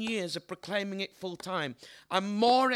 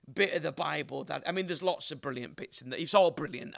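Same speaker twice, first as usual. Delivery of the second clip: ordinary talk, nothing marked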